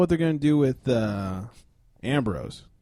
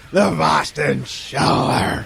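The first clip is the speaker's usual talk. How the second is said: dramatic announcer; much slower than usual. Pretend.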